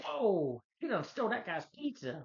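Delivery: high, loud mock astonishment; amazed onlooker. Bystander watching Spider-Man swing off, high exaggerated amazed outburst. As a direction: exclaim it like a stunned bystander.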